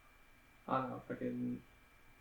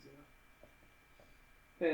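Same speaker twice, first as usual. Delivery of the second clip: ordinary talk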